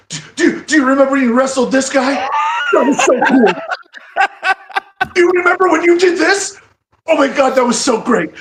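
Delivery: high, loud, breathless starstruck gushing; fanboy gush. Retold or imitated. Young fan gushing over wrestling heroes at dinner, high and loud. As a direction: gush at your heroes like a giddy kid. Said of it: "one thousand percent fanboy mode".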